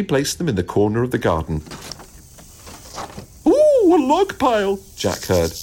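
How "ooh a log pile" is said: very high, slow, loud squeal; tiny bug voice cooing in delight; thrilled insects. A narrator's reading. Insects spotting the new log pile, thrilled.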